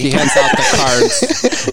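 squeaky laughing line, slowed by giggles; high laughing. Real, not acted. Friend jokes about the apology, high and laughing.

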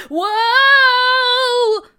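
long, high, loud whoa; drawn-out startled shout; slipping yell. A narrator's reading. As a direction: cry out as you slip. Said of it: "as he slipped on the ice".

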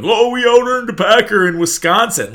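high, sneering gloating-fan voice; mock the Bears fans with a high, smug gloat. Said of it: "Bears fans were, like, jerking off to this".

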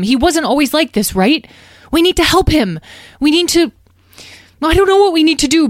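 high, loud, urgent youngster's appeal; earnest pleading young dragon. Acted. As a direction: plead with Mom, stumbling over words.

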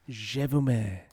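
low, soft French accent; fake French accent. Shown as a demonstration. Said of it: "a good fake French accent".

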